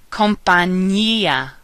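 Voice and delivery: slow Spanish "Compañía", as "kom-pah-NYEE-a". Shown as a demonstration. Teacher giving words to practice, NY sound in "Compañía". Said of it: "Ñ sounds like ña".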